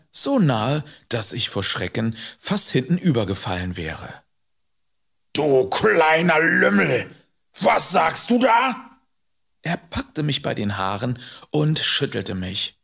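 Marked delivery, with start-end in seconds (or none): 5.3-8.9 slow, loud, high angry shout; furious. A narrator's reading. Enraged man pounces on accusing boy, shouting furiously. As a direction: roar it at the child, stretching the words. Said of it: "ganz blass vor Zorn".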